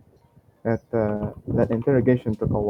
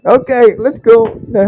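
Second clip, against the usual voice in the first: high, loud cheer; excited; 11 semitones higher, 9 dB louder than usual. Real, not acted. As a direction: pump it up with glee. Cheering the outcome.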